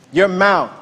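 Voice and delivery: usual speaking voice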